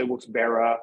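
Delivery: Wilkes-Barre with a final "uh", "berra"; "berra" pronunciation. Shown as a demonstration. Local listing how people say it, Wilkes-Barre as "berra". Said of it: "some people say".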